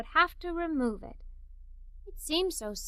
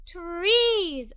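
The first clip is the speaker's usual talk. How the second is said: a bright, eager burst on one word; exclaimed; 4 semitones higher, 5 dB louder than usual. A narrator's reading. Child answering grandmother, exclaiming happily. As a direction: blurt it out like a kid who knows the answer. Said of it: "I exclaimed".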